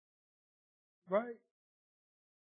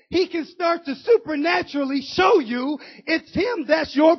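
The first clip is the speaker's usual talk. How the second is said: loud preacher's drive, pushing each phrase; preaching at full force; 5 semitones higher, 9 dB louder than usual. Acted. Preacher urging the church to give first, preaching hard.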